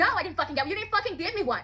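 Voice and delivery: high, indignant comeback, kept soft; angry retort. Retold or imitated. New worker protests to counselor about the shirt, angry retort. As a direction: re-enact an exasperated protest, rising in pitch.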